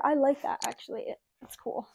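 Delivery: ordinary talk, nothing marked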